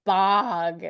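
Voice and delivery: "bog" for bag, open drawn-out vowel; mom's overcorrected bag. Retold or imitated. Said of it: "And she's like, "Oh, sorry".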